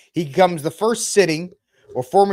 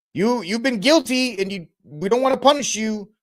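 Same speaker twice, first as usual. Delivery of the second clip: mock court voice; 8 semitones higher than usual. Mocking New York courts.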